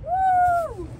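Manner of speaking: high, loud whoop of joy; excited whoop. Real, not acted. Whooping at a beach jade find, thrilled.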